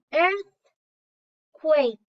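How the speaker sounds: Earthquake said in two beats, EARTH then QUAKE; syllable by syllable. Shown as a demonstration. Teacher splitting Earthquake for the class into two syllables. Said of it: "let's see how many syllables earthquake has".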